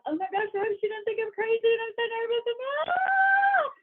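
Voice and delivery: high, rushed panicky babble ending in a yell; nervous panic. Shown as a demonstration. Coach acting out a nervous first message, high panicky yell. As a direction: blurt it out like a jittery seller who panics. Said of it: "from a place of".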